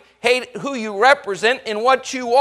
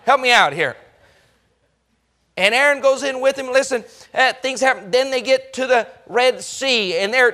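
ordinary delivery

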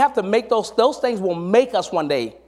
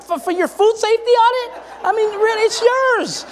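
incredulous. Retold or imitated. To the worker blaming him, high incredulous retort. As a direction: scoff at the absurd excuse, pitched way up.

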